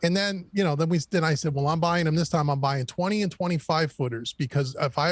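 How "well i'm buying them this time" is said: usual speaking voice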